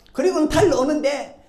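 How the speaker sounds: ordinary delivery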